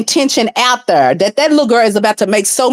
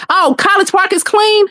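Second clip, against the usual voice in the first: high-pitched, disbelieving question; real astonished reaction; incredulous; 13 semitones higher than usual. Real, not acted.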